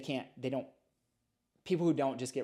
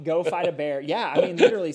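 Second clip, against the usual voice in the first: louder and higher, laughing it off but meaning it; emphatic loud insistence; 5 semitones higher, 12 dB louder than usual. Real, not acted. Answering the host's joke, loud emphatic insistence. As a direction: really punch it out, half joking. Emphatic reply to joking host.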